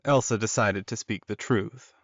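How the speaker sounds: ordinary delivery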